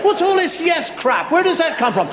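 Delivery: normal talking voice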